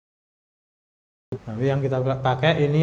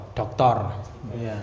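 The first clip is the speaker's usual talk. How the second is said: normal talking voice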